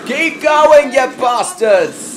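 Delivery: loud mocking shout at the kids; hammed-up shout; mock yell. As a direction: yell it like an angry boss.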